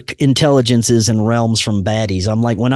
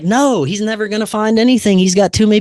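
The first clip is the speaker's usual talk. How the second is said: teasing; 9 semitones higher than usual. Retold or imitated. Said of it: "He's like".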